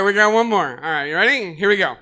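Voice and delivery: ordinary talk, nothing marked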